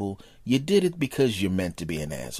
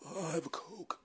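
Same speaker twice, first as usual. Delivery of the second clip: whimpering; 5 semitones higher, 12 dB quieter, slower than usual. Acted. Friend whimpering meekly.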